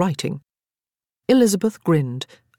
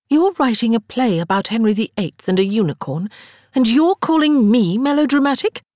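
rising in disbelief to the end; building outrage, higher and louder; incredulous; 7 semitones higher, 4 dB louder than usual. A narrator's reading. Sarah's incredulous retort.

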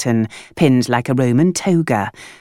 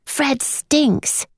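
put-on high, softer boy's verdict; much higher child's voice; blunt boy; 12 semitones higher, 3 dB quieter than usual. A narrator's reading. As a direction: flatly declare it.

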